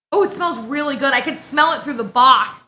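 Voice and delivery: ordinary talk, nothing marked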